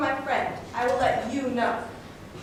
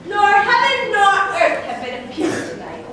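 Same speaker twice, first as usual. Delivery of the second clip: ordinary delivery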